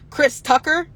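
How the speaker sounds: usual speaking voice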